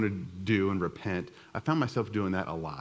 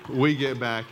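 laughing; 3 semitones higher, 3 dB louder, slower than usual. Real, not acted. Laughing at his own story.

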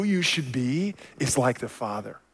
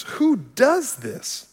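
higher, slow, drawn-out disbelief at who; mock objector asking who would ever do this; incredulous scoff; 8 semitones higher, much slower than usual. Objector scoffing at turning the cheek.

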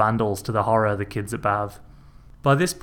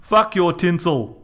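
slow, louder, defiant shout; 7 semitones higher, 5 dB louder, slower than usual. A narrator's reading. Reg shouting at his brother over the tinsel. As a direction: spit it out slowly in defiance. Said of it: "Reg shouted".

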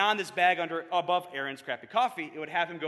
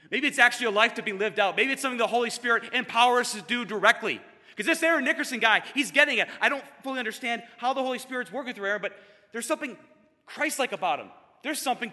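higher and louder, rushing faster at the end; excited realisation; 6 semitones higher, 4 dB louder than usual. Real, not acted. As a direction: build up as the idea takes hold. Growing realisation about faith.

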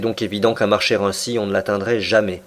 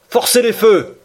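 shouted order; 10 semitones higher, 5 dB louder than usual. A narrator's reading.

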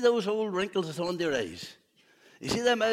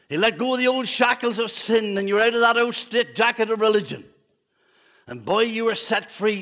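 raised, loud preacher's cry; fervent preaching; 3 semitones higher, 5 dB louder than usual. Acted. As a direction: preach it out with full force. Preaching climax.

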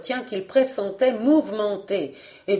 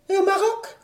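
very high rising query; high, persistent wife; insistent questioning; 11 semitones higher than usual. Acted. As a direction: try another country, high-pitched. Wife fires off another destination.

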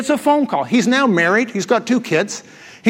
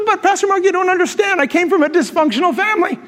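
higher mock protest from a congregant; whiny complainer; 10 semitones higher than usual. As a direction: play the whining churchgoer.

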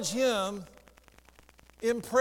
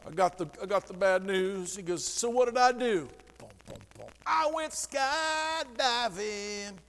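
country song voice. Retold or imitated. Pastor quoting the country song to his church, light mock croon. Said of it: "That's what that country song's about".

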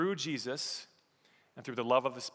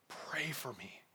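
much softer, earnest plea; quiet appeal; 11 dB quieter, slower than usual. Acted. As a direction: drop to a hushed, heartfelt request. First, hushed take of the appeal.

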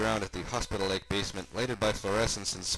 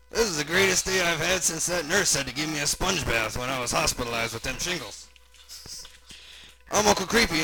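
loud, raised, boastful uncle voice; excited oddball uncle, pitched up; Uncle Creepy character; 7 semitones higher, 5 dB louder than usual. Acted. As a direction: play a folksy braggart uncle.

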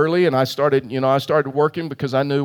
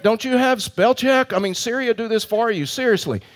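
exasperated; 7 semitones higher than usual. Retold or imitated. Pastor silently exasperated by the stranger's spelling questions.

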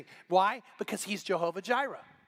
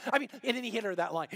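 normal talking voice

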